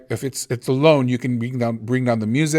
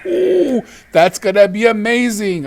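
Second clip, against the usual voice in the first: excited; 10 semitones higher, 7 dB louder than usual. Real, not acted. Delighted reaction to a sound effect.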